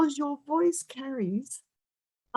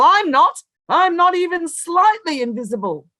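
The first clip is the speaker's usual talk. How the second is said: defiant; 7 semitones higher, 6 dB louder than usual. Real, not acted. Defiant rebuttal of invisibility claim.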